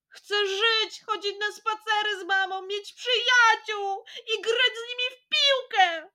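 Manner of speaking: high voice breaking into crying and laughter; crying plea. Acted. Jasiek longing for his old life, high crying plea. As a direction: plead through tears for his old life. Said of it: "krzyknął rozżalony Jasiek".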